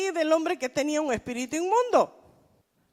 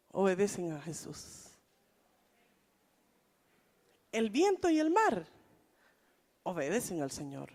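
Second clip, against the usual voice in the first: hushed emphasis; 6 semitones lower, 5 dB quieter than usual. Real, not acted. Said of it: "preacher drops voice for emphasis".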